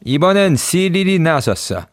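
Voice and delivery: ordinary delivery, nothing marked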